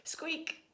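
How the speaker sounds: high, breathy whispered squeak; a tiny squeak barely voiced; faint chick squeak inside the egg.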